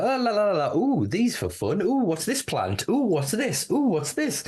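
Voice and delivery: high, carefree sing-song innocence; happily oblivious. Retold or imitated. Stoner mocking his own carefree innocence, high and sing-song. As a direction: skip along humming like nothing's wrong. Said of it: "I was happily like".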